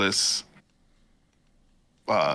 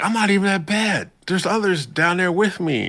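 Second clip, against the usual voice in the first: higher-pitched mock student voice; 11 semitones higher, 5 dB louder than usual. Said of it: "and he's like".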